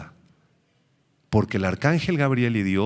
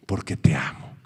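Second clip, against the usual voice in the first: breathy whisper; God whispering his love to a child; 7 semitones lower than usual. Acted. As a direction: whisper it close and loving. God answers tenderly.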